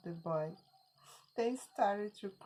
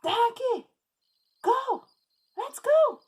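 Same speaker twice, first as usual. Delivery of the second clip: high, loud yell at the donkey; shouting boy; 12 dB louder than usual. Acted. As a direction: bellow it like an exasperated kid. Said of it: "The boy shouted a lot".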